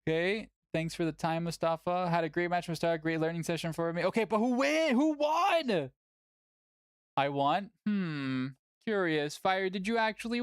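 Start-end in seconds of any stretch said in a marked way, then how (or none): 4.0-5.9 pitched up, shouting who won; demanding shout. Real, not acted.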